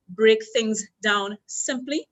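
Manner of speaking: slow, deliberate, every word weighed; slow emphatic repeat. Shown as a demonstration. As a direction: spell the point out slowly for the class. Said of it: "That's the first point".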